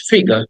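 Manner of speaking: "ফিগার" with the final r left silent; British pronunciation. Shown as a demonstration. Teacher contrasts "ফিগার" for students, British silent r. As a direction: end "ফিগার" on an open "ga". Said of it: "এটাকে আরটাকে ব্রিটিশরা উচ্চারণ করে না".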